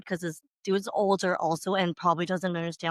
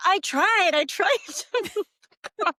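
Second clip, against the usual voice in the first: Victor protesting; 8 semitones higher than usual. Retold or imitated. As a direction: play up his helpless pleading. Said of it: "He's like".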